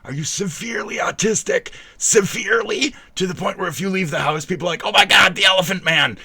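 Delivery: high, loud, hammed-up tirade; mock outraged rant. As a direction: build to a high, shouted mock horror. Acted rant mocking the viewer.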